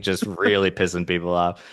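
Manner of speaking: ordinary delivery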